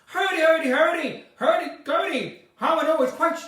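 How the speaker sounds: high nonsense in Northern Irish tune; failed Northern Irish accent. Shown as a demonstration. Admitting she can't do it, bad Northern Irish accent. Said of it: "I can't do Northern Ireland accent".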